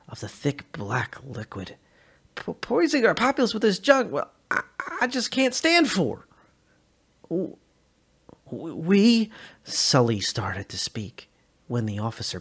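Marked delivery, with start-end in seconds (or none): 2.3-6.3 high, loud sputtering indignation; mock outrage. A narrator's reading. Officer mocks outrage loudly. 7.3-9.3 high, loud broken stammer; frightened stammer. A narrator's reading. Sully stammers before being hit.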